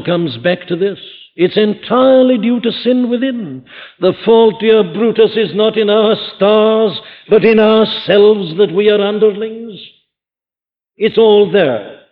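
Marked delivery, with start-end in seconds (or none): 1.4-12.0 louder, higher climactic pulpit cry; full-force preaching. Acted. Preacher reaching the climax of his sermon on sin, full-force preaching. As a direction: build to a crying plea for healing.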